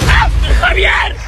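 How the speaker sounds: high slow shriek. Acted. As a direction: shriek out loud in shock. Mother splashed in pool.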